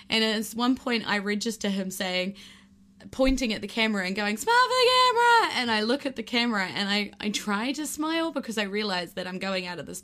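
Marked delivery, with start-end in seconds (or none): 4.4-5.6 much higher, loud cheery shout; shouting cheerfully. Retold or imitated. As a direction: yell it over the wind. Instructor shouting over the wind.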